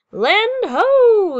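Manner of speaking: high, long lookout call; loud, drawn-out sailor's cry; first mate's yell. A narrator's reading. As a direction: holler it from the crow's nest. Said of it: "yells First Mate Kelly".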